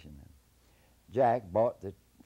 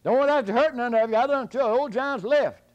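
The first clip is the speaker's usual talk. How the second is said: very high, loud fast shout; high shouted warning; hollering; 15 dB louder than usual. Acted. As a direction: bluff off the army. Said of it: "Jack kept hollering out".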